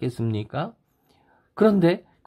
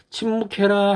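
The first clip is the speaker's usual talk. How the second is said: high, sustained order; preacher's acted commandment; proclaimed command; 10 semitones higher than usual. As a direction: call out the order on a high pitch. Preacher acts out the command again.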